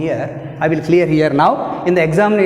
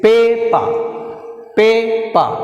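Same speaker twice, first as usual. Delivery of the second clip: "Paper" with a high, held PA, then a drop; teacher modelling "Paper", stressed at the start; first-syllable stress; 4 semitones higher, much slower than usual. Shown as a demonstration. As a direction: push PA, let "Paper" fall away. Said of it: "first syllable".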